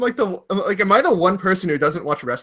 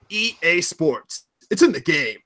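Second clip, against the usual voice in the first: slogan announcer; 2 semitones lower than usual. Acted. Host opens the EA topic with its slogan, announcer voice. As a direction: draw it out like a brand tagline. Mimics the game company's slogan.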